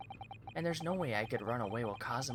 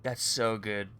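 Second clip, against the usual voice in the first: delighted; 2 semitones lower, 7 dB louder, slower than usual. Real, not acted. Repeating delight at the name.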